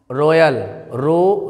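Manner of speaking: रॉयल with a round O, then a long "ro"; slow mistaken O reading of रॉयल; wrong pronunciation. Shown as a demonstration. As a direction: say रॉयल as "ro-yal", then hold रो. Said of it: "गलत हुआ है".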